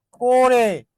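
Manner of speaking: করে said "ko-re", closed o; o-sound pronunciation. Shown as a demonstration. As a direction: say করে with an o-kar, "ko-re". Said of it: "উচ্চারণটা আমার কি?". (করে-কে ওকার দিয়ে "কোরে" উচ্চারণ)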